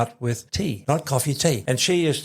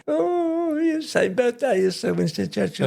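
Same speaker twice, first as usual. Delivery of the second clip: high, sing-song posh granny voice; a fond mimic of a snobbish nan; imitating grandmother; 11 semitones higher than usual. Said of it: "she used to pat me on the head and say".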